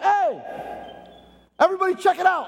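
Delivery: high, proud announcement to everyone; excited calling out. Acted. Father announcing his son's return to everybody, high and excited. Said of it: "the father turns around and says".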